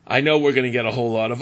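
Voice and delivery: ordinary talk, nothing marked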